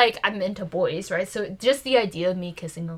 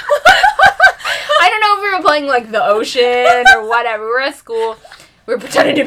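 laughing; 3 semitones higher, 12 dB louder than usual. Real, not acted. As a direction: laugh while you talk. Laughing through the story.

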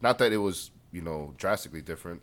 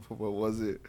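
ordinary delivery

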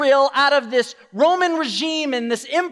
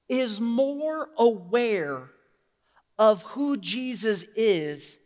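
ordinary talk, nothing marked

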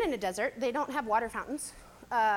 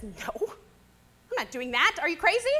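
slow refusal that jumps high and loud; hammed-up outraged balk; mock defiant Hagar; 3 semitones higher, 4 dB louder than usual. Hagar joking back at the angel, slow then shrill. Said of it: "Just kidding, that's not what she says".

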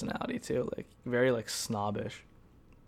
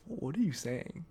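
halting, softer, rising to a high question; baffled disbelief; 4 semitones higher, 5 dB quieter than usual. Retold or imitated. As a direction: act out stunned inner questions. Retold baffled thoughts at date.

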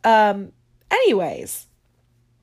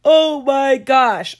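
slow words through a yawn; stretched out mid-yawn; 4 semitones higher, 8 dB louder, slower than usual. Real, not acted. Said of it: "I am just yawning, yawning, yawning".

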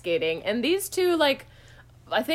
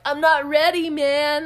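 higher, louder slacker dude; mock dude voice; 5 semitones higher, 5 dB louder than usual. Host mocking forty-year-old Sam, lightly voiced slacker. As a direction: toss it off like a commitment-shy bro. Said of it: "for him to be like".

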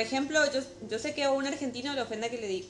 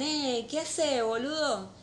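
light mock Buenos Aires porteño accent, a bit higher; 4 semitones higher, much slower than usual. Mocking how porteños talk to friends, light porteño accent. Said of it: "O sea, ese acento".

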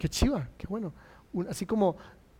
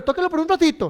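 bright, higher and louder Joy; the film's Joy, higher and louder; voicing the Joy character; 9 semitones higher, 4 dB louder than usual. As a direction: play the bossy Joy handing over the controls.

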